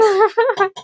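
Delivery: sudden high burst of laughter after a fib. Real, not acted. Laughing at her own cover story to the viewers. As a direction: laugh it off after the innocent excuse.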